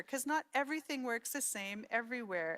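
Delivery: ordinary talk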